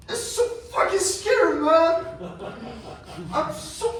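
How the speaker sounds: high, loud panicky confession; scared. Acted. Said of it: "I'm so fucking scared right now".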